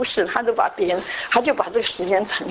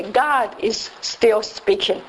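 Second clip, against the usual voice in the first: slow sign reading; much slower than usual. Shown as a demonstration. Reading the church sign aloud.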